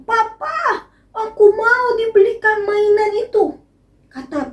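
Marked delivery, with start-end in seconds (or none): 0.0-3.4 put-on high, eager little kid voice; child asking papa. Child asking papa for a toy at the mall, high and eager.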